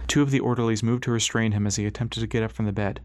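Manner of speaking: ordinary delivery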